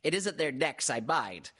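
high-pitched, earnest little character; earnest Piglet. A narrator's reading. Piglet confides a worry to Pooh, earnest. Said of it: "said Piglet earnestly".

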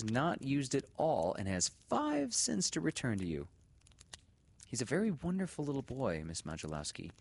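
high, bright-toned teacher voice; teacher addressing mother. A narrator's reading. Teacher telling the mother about the money, high and polite. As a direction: speak politely to his mother.

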